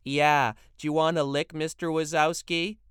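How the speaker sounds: a cheeky girl mocking her friend's father; trilling teen girl. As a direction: tease the grown-up with a lilting trill. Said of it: "One of the girls trilled".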